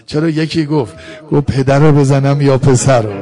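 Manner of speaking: very loud mourning sermon cry; full-force lament. Acted. Preacher telling mourners of Hussein and the child, full-force lament. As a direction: cry out the tragedy at full volume.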